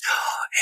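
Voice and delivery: soft breathless gasp of delight; whispered gasp of wonder. Pretend. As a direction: gasp softly as if amazed. Imagined dancer's delight.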